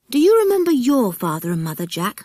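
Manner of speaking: put-on a boy eager to know, rushed; curious boy. Mike asks Jack about his parents, quick and eager.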